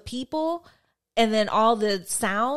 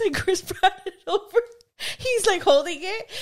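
words squeezed out through real laughter; laughing; 8 semitones higher than usual. Real, not acted. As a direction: laugh helplessly while you tell it. Laughing while retelling the churro moment.

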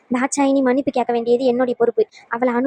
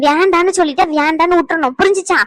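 angry scolding; 5 semitones higher, 5 dB louder than usual. Acted. Scolding a child in a quarrel.

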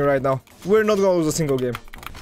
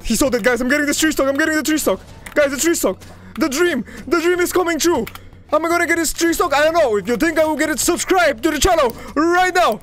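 hyped excitement; 9 semitones higher than usual. Real, not acted. Streamer hyping his viewers about the three stock, high excited voice. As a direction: ride the rush of almost winning big.

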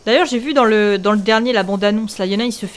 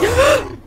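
startled gasp; 12 semitones higher than usual. Real, not acted. Second Xenoqueen spawns, scare.

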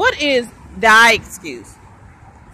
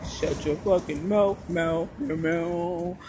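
low growling cat; 7 semitones lower, 7 dB quieter than usual. Pretend. Sudden low voice.

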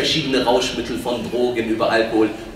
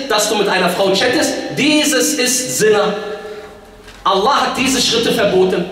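raised, insistent preaching voice; forceful preaching; 7 semitones higher, 4 dB louder than usual. Real, not acted. Preacher explaining what Allah has forbidden, forceful. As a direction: hammer out each point from the pulpit.

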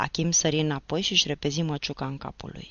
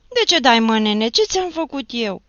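much higher and louder, horrified protest; terrified cry; 7 semitones higher, 10 dB louder than usual. Acted. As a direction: cry out in shock after the slap. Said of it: "strigă Nicolae îngrozit". (strigăt îngrozit, mult mai sus și tare)

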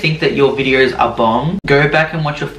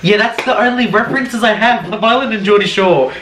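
put-on 1 Love Island accent; 8 semitones higher than usual. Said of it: "You sound like someone from Love Island".

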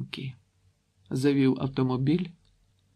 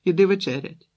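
louder, higher call across the house; calling to supper; 6 semitones higher, 6 dB louder than usual. A narrator's reading. Mother calls daughter to supper, raised voice. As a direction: shout it to the next room. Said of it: "гукнула Ольга".

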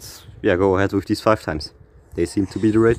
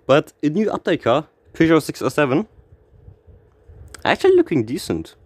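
ordinary delivery

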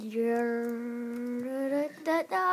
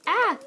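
yelp of surprise; 8 semitones higher, 6 dB louder than usual. Real, not acted. Hit in the game.

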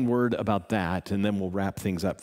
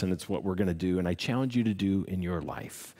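ordinary delivery

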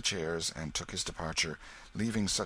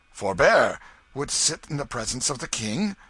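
sharp, much louder royal rebuke; indignant; 6 semitones higher, 9 dB louder than usual. A narrator's reading. Boy king rebuking Hendon for sitting, indignant and loud. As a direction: scold him for sitting down. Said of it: "the boy said indignantly".

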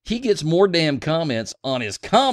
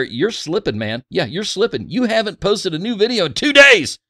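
mock nagging rant; 9 semitones higher, 6 dB louder than usual. Reading a viewer's complaint aloud.

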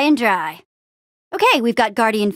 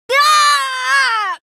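long, high, loud scream; startled scream; 4 dB louder than usual. Acted. Hero caught by a sudden fright, screaming. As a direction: scream out in alarm.

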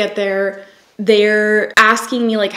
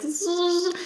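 high, soft buzzing fan noise; 10 semitones higher, 7 dB quieter than usual. Retold or imitated. Describing husband's shitty fan during labor, high buzz. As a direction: imitate a cheap little fan whirring. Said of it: "fan that was like".

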